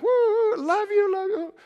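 squealing, high-pitched burst of affection; hammed-up mood swing at its peak; mock emotional high. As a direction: gush it in a giddy put-on high voice. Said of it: "just all up and down emotionally".

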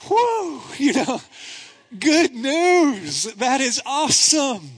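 high, loud rush of joy; elated exhale into good news; joyful whew. Real, not acted. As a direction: let the relief burst out. Recalls the marriage proposal.

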